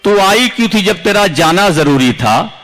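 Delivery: normal talking voice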